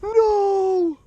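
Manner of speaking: very high, loud cry of no; real anguished yell; screaming as the fish is lost; desperate shout. Real, not acted. Trout escapes near a gator.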